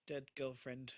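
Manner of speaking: normal talking voice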